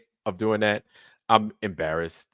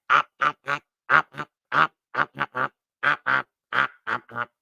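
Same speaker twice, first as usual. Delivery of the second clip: clipped repeated op sounds, pitched up; pecking bird noise; 4 semitones higher than usual. Retold or imitated. Streamer mimicking his bill pecking at the mic, clipped ops. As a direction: peck out the ops like a beak tapping. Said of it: "my bill is over here like".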